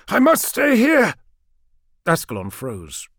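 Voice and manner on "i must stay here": high, loud, resolute cry; anguished raised-voice resolve; strained insistence. A narrator's reading. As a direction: refuse rescue at full voice. Trapped lord refuses rescue.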